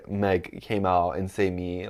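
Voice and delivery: ordinary talk, nothing marked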